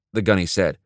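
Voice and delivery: usual speaking voice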